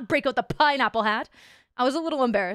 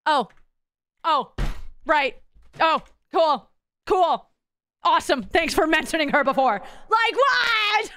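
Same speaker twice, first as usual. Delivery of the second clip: sarcastic; 3 semitones higher, 3 dB louder, slower than usual. Real, not acted. Sarcastic reaction to wife mention.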